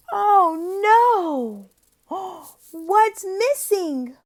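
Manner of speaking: high, loud dismay with a big gasp; hammed-up shock for a small child; mock alarm. Shown as a demonstration. Acted alarm at missing animal.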